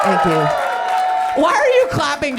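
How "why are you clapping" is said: higher, louder, incredulous; mock outrage. Real, not acted. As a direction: call out the clapping audience, faking shock. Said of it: "mock outrage at applauding crowd".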